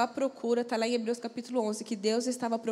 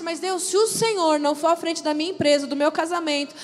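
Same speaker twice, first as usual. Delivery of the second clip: loud, driving preacher's appeal; rising revival-style exhortation; fervent preaching; 2 semitones higher, 9 dB louder than usual. Acted. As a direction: preach it out with full force. Impassioned appeal to congregation.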